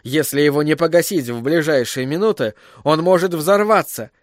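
usual speaking voice

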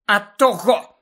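snapping back. A narrator's reading. Portrait answering Harry's complaint, snapping back. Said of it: "огризнулася гладка пані".

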